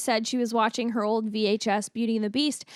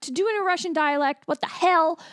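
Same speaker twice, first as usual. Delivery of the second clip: exasperated rant; 7 semitones higher than usual. Retold or imitated. Viewer fed up with accents in period films, high exasperated outburst. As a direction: fling it out in disbelief. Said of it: "I'm like".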